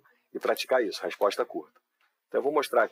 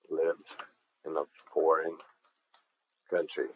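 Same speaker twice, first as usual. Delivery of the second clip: lived, in a foreign, country, with long gaps and softer; slow, word by word; 5 semitones lower, 4 dB quieter, slower than usual. Shown as a demonstration. Teacher breaking the phrase apart for students, slow and soft. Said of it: "Observem aqui no foreign".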